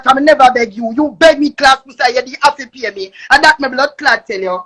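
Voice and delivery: ordinary delivery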